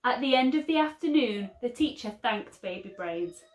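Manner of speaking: ordinary delivery, nothing marked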